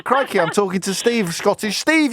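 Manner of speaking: high-pitched imagined caller's astonishment; mock amazement. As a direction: tease with exaggerated, high-pitched excitement. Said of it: "You thought".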